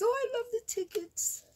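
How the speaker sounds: high-pitched squeal of delight; squealing with love for it; thrilled squeal. Real, not acted. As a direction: gush in a tiny high voice. Thrilled by tickets in gift.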